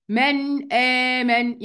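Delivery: من آمن very slow, noon of من fully sounded before the hamza; slow tajweed من آمن; clear izhar. Shown as a demonstration. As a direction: recite من آمن slowly with a full noon. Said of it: "هنطق النون كاملة".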